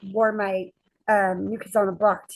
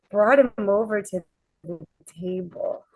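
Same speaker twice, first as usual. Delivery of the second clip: normal talking voice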